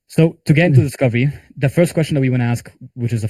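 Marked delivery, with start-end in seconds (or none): none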